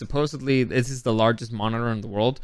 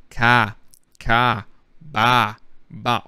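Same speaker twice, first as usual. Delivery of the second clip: single words drilled in an Australian accent; Australian accent practice; much slower than usual. Shown as a demonstration. Vlogger trying to get the accent back, Australian accent. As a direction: find the Australian vowel, word by word. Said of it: "I'm losing the accent a little bit".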